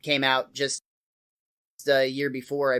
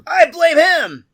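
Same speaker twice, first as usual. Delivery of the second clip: whiny passenger voice; 11 semitones higher, 4 dB louder, slower than usual. Pretend. Voicing passengers blaming Bart.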